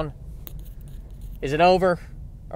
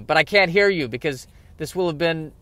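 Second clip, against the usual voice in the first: usual speaking voice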